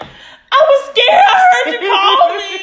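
high, loud country wail, half laughing; real country. Retold or imitated. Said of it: "I just got real country 'cause I got so scared".

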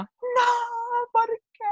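very high hammed-up wail; mock despair. Retelling being picked up too soon, high mock wail.

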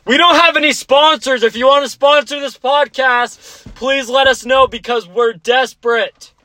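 high, loud pleading plea; overplayed begging for sponsors; mock desperate. Real, not acted.

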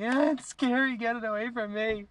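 high scared whine; whimpering scared. Pretend. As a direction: whimper like a terrified kid. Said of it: "weep and be like".

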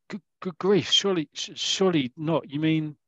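high, stammering disbelief; acted incredulous surprise; astonished Roy. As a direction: stammer it high as if stunned by the news. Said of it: "And Roy goes".

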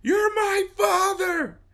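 high shouting fanboy; mock fan shout. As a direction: yell it like a starstruck fan.

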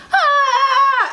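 high laughing mock scream; retold scream. Classmates shrieking at the shaking cabinet, mock scream.

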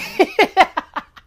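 a high, loud laugh breaking out; bursting laugh. Real, not acted. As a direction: laugh out loud at your own joke. Laughs at own Forky line.

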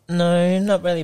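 high, loud, through giggles; high giggly no. Real, not acted. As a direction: answer with a nervous laugh. Shy reply at interview end.

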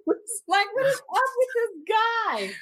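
high-pitched, incredulous complaint; exasperated. Retold or imitated. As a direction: whine it in baffled exasperation. Acted exasperation at the sobbing man.

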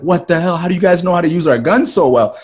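high, loud incredulous outburst; mock astonishment. Germans baffled that Ethiopians can use their guns, astonished.

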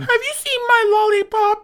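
put-on very high, loud little-girl voice; ten-year-old girl. Terminator designed as a little girl, high innocent child voice. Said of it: "like, a ten-year-old girl".

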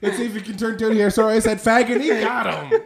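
high, shouted play-by-play; baseball voice. Acted. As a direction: race through it as an excited announcer. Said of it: "Imagine the baseball voice saying that".

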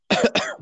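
short real cough; chesty cough. Real, not acted. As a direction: cough once, apologetic. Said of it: "chiedo scusa per la tosse".

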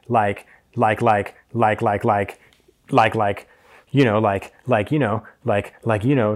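ordinary delivery

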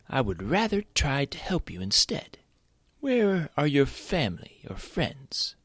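high, slow whispered offer; whispering. Acted. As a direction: whisper it gently. Vulture offering help secretly.

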